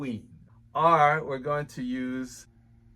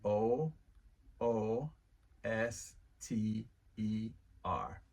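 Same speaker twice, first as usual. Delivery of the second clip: slow spelling; 4 semitones lower, 6 dB quieter, slower than usual. Shown as a demonstration. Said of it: "You can say R".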